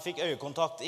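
ordinary talk, nothing marked

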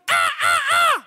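high, piercing bird squawks; imitated bird screech. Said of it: "de skriker skikkelig, sånn". (etterlignet, skingrende fugleskrik)

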